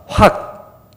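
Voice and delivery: a quick breathy burst of air; hissed exhale to shoo someone off; sharp hissing huff. Shown as a demonstration. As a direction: blow out a sudden dismissive huff. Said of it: "이건 제가 한 겁니다, 확은".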